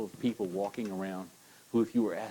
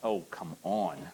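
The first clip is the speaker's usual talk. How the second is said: usual speaking voice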